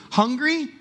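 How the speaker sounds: high cheerful ask; bright playful question. Acted. Jesus asking the revived girl if she's hungry, playful. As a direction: tease her with breakfast.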